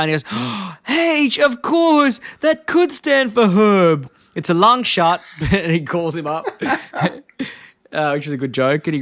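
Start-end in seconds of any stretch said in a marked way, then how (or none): 0.3-0.8 sharp acted intake of breath; mock gasp of realization. Acting Homer's sudden realization. 0.9-5.2 very high-pitched hammed-up realization; mock eureka. Acting Homer's sudden realization.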